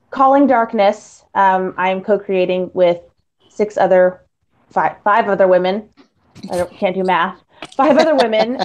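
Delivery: ordinary talk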